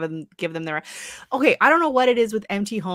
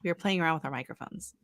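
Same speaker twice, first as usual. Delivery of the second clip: ordinary talk, nothing marked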